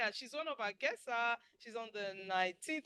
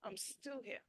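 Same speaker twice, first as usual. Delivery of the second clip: caring soft voice; 4 semitones lower, 14 dB quieter than usual. Shown as a demonstration. Caring spouse checking in.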